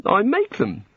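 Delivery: high, bright-eyed tinkerer god; eager god voice. A narrator's reading. The god speaks.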